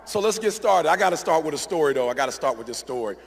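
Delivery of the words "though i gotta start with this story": usual speaking voice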